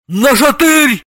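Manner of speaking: high, urgent holler; drawn-out yell for a remedy. A narrator's reading. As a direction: cry out for help. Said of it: "Дед Мороз завопил".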